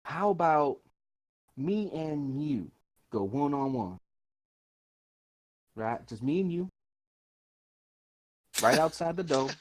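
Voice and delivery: slow, deliberate challenge with pauses; swaggering adventurer. Acted. Adventurer challenging bandit leader to a duel, measured swagger. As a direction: draw out the dare lightly in character. Character proposes a one-on-one duel.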